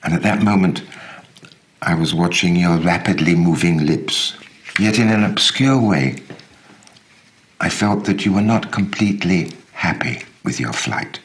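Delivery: measured, intimate letter reading; story narration. A narrator's reading. Reader voicing a letter to his friend Mustafa, measured narration. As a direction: read it like a heartfelt letter to an old friend.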